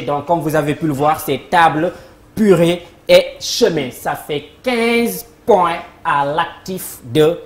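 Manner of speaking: ordinary talk, nothing marked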